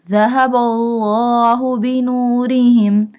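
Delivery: "الله" chanted with a heavy, full l; bold Allah. Shown as a demonstration. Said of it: "pronounce Allah boldly". ("الله" with thick rounded l in recitation)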